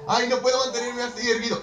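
whiny anime character. Retold or imitated. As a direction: whine like a helpless anime hero. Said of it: "Que están siempre".

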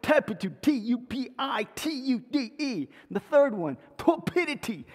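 put-on spelling words out in a light Cockney accent; Cockney lad spelling it down the phone. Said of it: "trying to pronounce these words through his Cockney accent".

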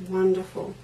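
ordinary delivery

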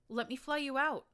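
high, loud offer; Glory yelling. Acted. Morning Glory yells to the narrator, high and loud. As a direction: yell it over the fight. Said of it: "Morning Glory yelled".

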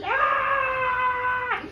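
high loud terrified scream. Acted. Man screaming to block out fairy music. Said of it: "screamed to block out the music".

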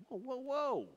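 high-pitched halting interjection; mock protest. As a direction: cut your brother off, pitch jumping up. Retold protest to a critical brother.

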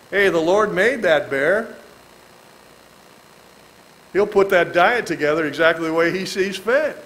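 ordinary talk

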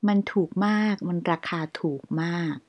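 ordinary talk

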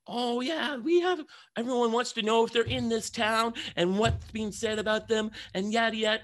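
high, bubbly gossip-show host voice; bubbly reporter. Retold or imitated. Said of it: "she was very bubbly".

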